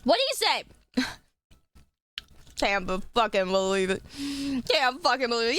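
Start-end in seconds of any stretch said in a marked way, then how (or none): none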